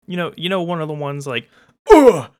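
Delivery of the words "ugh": loud sharp high grunt; tennis grunt. Shown as a demonstration. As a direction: grunt like hitting a serve. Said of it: "give me your best tennis grunt".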